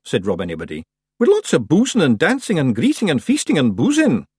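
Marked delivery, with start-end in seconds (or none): 1.2-4.3 loud, high little Scots man; cheery Feegle. A narrator's reading. Feegle chief cheering about a funeral. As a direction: cheer for a wake.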